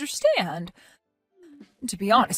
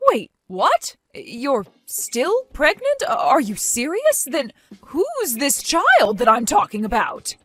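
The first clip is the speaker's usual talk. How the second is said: slow, higher, stunned questions; stunned disbelief; 3 semitones higher, slower than usual. Acted. Martha realising Sally is still pregnant, slow stunned disbelief. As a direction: let the shock slow every word down.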